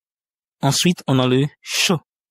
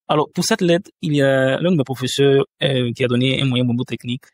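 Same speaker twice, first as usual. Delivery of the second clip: ordinary delivery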